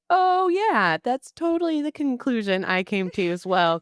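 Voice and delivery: high, loud mock-agreeing sarcasm; oh yeah, dripping with sarcasm; sarcastic reply. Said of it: "And I was like".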